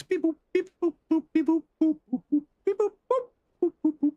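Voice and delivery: very high run of vocal beeps; high little computer boops; mock sound effects. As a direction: imitate a busy scanner bleeping. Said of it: "Whatever those sound effects are".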